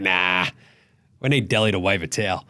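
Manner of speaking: low, dismissive mock selector. Mocking selectors picking Delhi over Matisse, low and dismissive. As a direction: drop low and wave it off.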